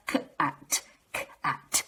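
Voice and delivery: breathy whispered blend; whispered sounding out. Shown as a demonstration. Teacher whispering the blended sounds to children. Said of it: "Say it faster".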